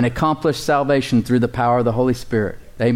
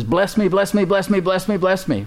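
higher-pitched, repetitive begging; insistent pleading; 6 semitones higher than usual. Acted. Jacob begging the angel for a blessing, pleading insistently.

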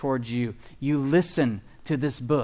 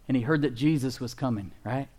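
ordinary delivery

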